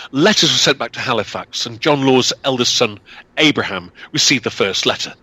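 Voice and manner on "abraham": plain reading voice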